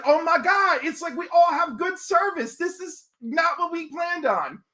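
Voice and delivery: high-pitched retold disbelief; mock astonishment. Streamer retelling the surprise of good service, mock astonishment. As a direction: pitch it high, imitating amazed dismay.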